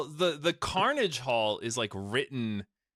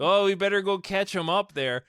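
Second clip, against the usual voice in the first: hammed-up Canadian accent, higher; mock Canadian with a perky "eh"; cartoon Canadian accent; 7 semitones higher, 4 dB louder than usual. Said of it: "just the Canadian accent".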